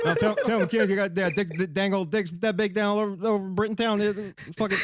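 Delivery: garbled, run-on Texas mumble; Boomhauer. Acted. Cartoon Texan relaying a message to Britain, garbled mumble. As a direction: tumble the words into Texan gibberish. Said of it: "I'm doing Boomhauer".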